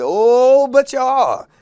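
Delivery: high, loud, teasing holler; hollered reply. Retold or imitated. Host cutting off a rapper on stage, loud teasing holler. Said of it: "And I hollered".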